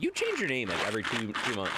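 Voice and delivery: normal talking voice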